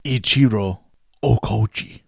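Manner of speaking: Ichiro Okouchi said carefully, Japanese vowels; slow careful Ichiro Okouchi with even syllables; Japanese pronunciation. Shown as a demonstration. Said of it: "That's how you pronounce it".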